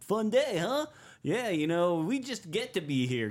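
high, loud, cheery guy voice; mock upbeat runner; guy next to me. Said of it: "the guy next to me is like".

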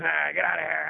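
rough mock shout; retold ranting yell. Imitating the man's rant.

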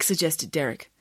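usual speaking voice

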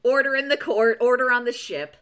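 loud, commanding call to order. Real, not acted. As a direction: bang the gavel with your voice. Calling podcast to order.

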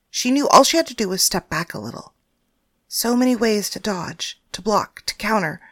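usual speaking voice

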